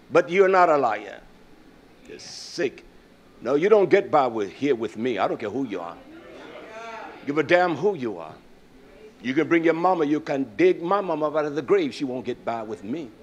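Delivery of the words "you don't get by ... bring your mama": normal talking voice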